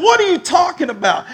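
high-pitched, loud baffled protest; indignant disbelief. Retold or imitated. Accused man hearing his son told on him, indignant. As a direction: squawk it back in disbelief. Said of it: "I was mad".

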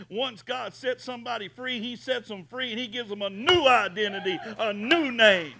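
rising to a loud climax; booming preaching. Acted. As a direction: build up to a loud cry. Preaching at full force.

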